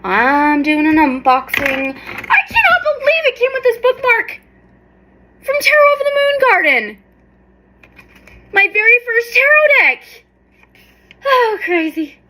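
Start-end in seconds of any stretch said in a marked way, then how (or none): 1.9-12.2 much higher, gushing disbelief; amazed. Real, not acted. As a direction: gush in delighted disbelief. Amazed by the bookmark.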